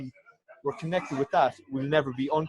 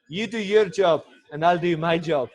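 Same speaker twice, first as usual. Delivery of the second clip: a hint of a stern teammate, higher and louder, laughing; quoting Neil Hartman; 4 semitones higher, 8 dB louder, slower than usual. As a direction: lightly mimic the old teammate's catchphrase through a laugh. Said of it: "Famous quote from him".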